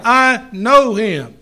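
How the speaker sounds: slow, firm weight on KNOW; "I know him" with KNOW stretched out and HIM falling; stress on KNOW. Shown as a demonstration. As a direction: press slowly on the word "know". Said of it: "the latter is the idea or the sense".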